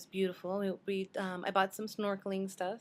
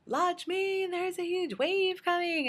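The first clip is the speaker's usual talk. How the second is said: high, louder, slow alarmed warning; warning shout; 10 semitones higher, 5 dB louder, slower than usual. Retold or imitated. Roommate warning Lakshmi of the wave, high alarmed shout. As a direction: yell the warning from the shore. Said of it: "she says".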